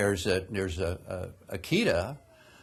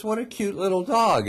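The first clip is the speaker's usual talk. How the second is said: put-on much higher, sweet baby-talk coo; cooing at a puppy; 11 semitones higher than usual. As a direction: coo over the little dog like to a baby. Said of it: "Richard Gere picks him up and says".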